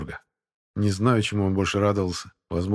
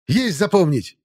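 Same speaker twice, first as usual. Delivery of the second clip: barked reply; 9 semitones higher, 5 dB louder, slower than usual. A narrator's reading. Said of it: "гаркнул Бернес".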